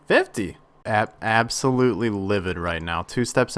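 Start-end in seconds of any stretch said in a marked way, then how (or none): 0.1-0.6 sharp, louder exclamation of disbelief; exasperated outburst. Real, not acted. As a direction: throw the number out in outrage. Outraged at the drop count.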